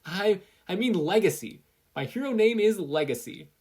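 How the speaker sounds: high and loud, hurried correction; flustered fix. Acted. Izuku quickly correcting his hero name, flustered. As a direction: correct yourself in a panic.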